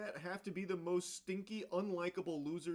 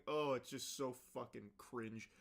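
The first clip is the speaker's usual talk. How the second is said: usual speaking voice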